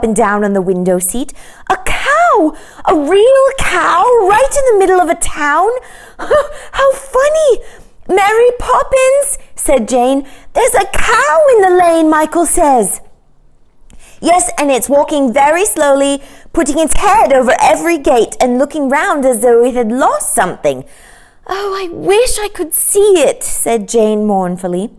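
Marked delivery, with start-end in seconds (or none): 1.7-13.0 put-on a delighted child who can't believe it; giggly excited girl. Jane tells Mary Poppins about the cow, high and giggling. 14.2-23.4 put-on high childlike voice; wistful kid eager to see; eager child. Children at the window talk about the cow, high and eager.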